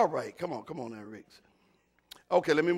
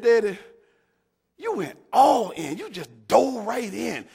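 son's words; 8 semitones higher, 8 dB louder than usual. Retold or imitated. Preacher voices his son's words.

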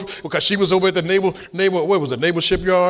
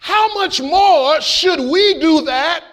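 high, slow, drawn-out preacher's climax; preaching at full force; 9 semitones higher, 3 dB louder, much slower than usual. Acted. Pastor driving home the lesson to the church, preaching at full force. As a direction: build the final point for God and Christ.

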